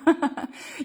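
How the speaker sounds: short breathy surprised laugh; a quick unvoiced giggle at seeing Mom's note; light real laugh. Real, not acted. Greets her mom mid-livestream.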